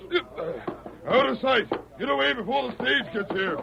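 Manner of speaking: high, hurried order to get away; urgent shout. Acted. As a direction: drive the men off in a hurry. Boss hurrying men away.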